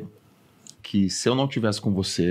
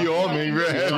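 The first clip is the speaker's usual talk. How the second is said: amused mock admiration; laughing; 7 semitones higher than usual. Real, not acted. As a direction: laugh while praising.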